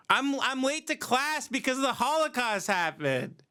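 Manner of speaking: high-pitched mock student excuse; whining student. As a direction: whine it like a fake victim.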